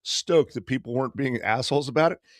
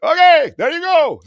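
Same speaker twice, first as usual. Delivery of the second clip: imitating a driver's high, loud announcement; shouting driver; 11 dB louder than usual. Driver dropping riders at the beach, shouting. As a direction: holler it high and loud, triumphant.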